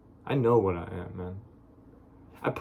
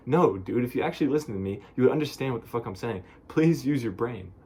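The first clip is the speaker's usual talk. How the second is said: raised, pleading exasperation; exasperated retort; 3 semitones higher, 4 dB louder than usual. Real, not acted. To people who don't get him, exasperated.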